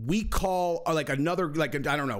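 ordinary talk, nothing marked

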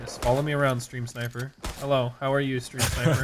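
low, soft, deadpan mock welcome; sarcastic greeting. Real, not acted. Streamer addressing a stream sniper, sarcastic. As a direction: greet the pest in a subdued, sour monotone.

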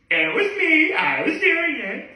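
high, tickled retelling; gleeful. Retold or imitated. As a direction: squeal it out delighted.

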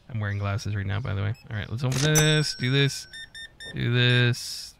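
normal talking voice

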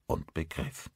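ordinary delivery

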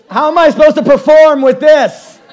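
high, loud hammed-up complaint; mock outrage. Comic on stage, outraged at his broken hoop.

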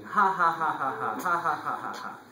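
mock laugh, spelled-out ha-ha-ha; goat laughing. A narrator's reading. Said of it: "Started laughing".

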